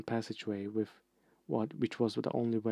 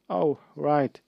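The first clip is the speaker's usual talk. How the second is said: realizing; 4 semitones higher, 8 dB louder than usual. Acted. Sudden realization.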